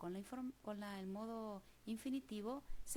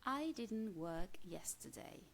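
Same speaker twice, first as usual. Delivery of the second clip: slow, careful example sentence; slow model sentence; slower than usual. Shown as a demonstration. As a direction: read it slowly for students to copy. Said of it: "Y fíjense también aquí qué ocurre". (frase de ejemplo lenta y cuidada)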